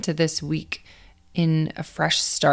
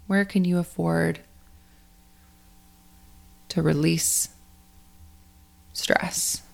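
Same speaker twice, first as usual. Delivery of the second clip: guided meditation; 4 dB quieter than usual. Acted. Coach guides athletes to let go of last week, in a guided meditation voice. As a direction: ease listeners through the breathing, unhurried and spacious.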